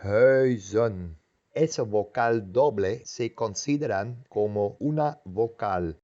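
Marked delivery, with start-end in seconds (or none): 0.0-1.2 "huizen" with UI as one long rounded vowel. Shown as a demonstration. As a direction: sound out "huizen" in two syllables. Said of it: "es E".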